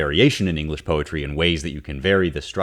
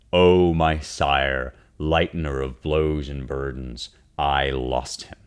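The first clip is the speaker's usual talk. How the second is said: drawn-out translation; 3 semitones lower, slower than usual. Shown as a demonstration. Teacher reads his translation, drawn out and slow. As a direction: linger on each phrase like a dirge. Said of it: "to really draw out".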